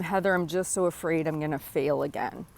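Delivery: ordinary talk, nothing marked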